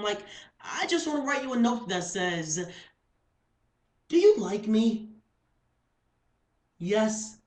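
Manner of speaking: high, excited, goofy confession; lovestruck spoken-word performance. Acted. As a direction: act out a tongue-tied crush for the audience. Poem's lovestruck speaker.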